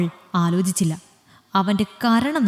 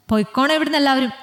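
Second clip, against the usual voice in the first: angry order; 6 semitones higher, faster than usual. Acted. Mithra orders the group out.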